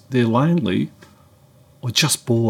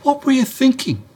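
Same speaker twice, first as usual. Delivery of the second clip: much higher, exasperated scolding; telling off; 14 semitones higher than usual. Retold or imitated. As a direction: give him a mock dressing-down. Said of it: "And then I gave it to him".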